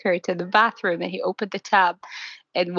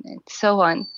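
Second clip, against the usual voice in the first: usual speaking voice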